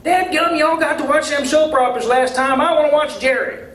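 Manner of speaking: high, loud Southern complaint; a hollering local who wants Jerry Springer on. Acted. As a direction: gripe at the crowd, demanding his show. Crowd member demanding show.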